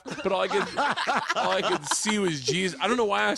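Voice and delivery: high voice breaking into laughter; real laughter while telling it; laughing. Real, not acted. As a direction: talk through the giggles. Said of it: "I could not stop laughing".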